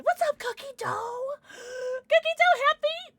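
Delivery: sing-song streamer greeting; cutesy squeal greeting. Real, not acted. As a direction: squeal a welcome to the viewer.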